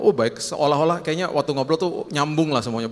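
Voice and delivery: normal talking voice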